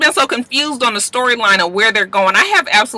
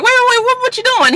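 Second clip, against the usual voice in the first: very high-pitched imitation of alarm; panicked protest; faster than usual. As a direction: squeak it like someone stopping a person leaving. Michaela stops Asher from leaving.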